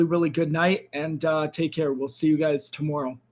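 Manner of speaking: ordinary talk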